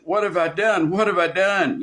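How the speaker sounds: acting out blameless indignation; self-interested protest. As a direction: toss it back as a mock protest of innocence. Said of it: "a self-interest way".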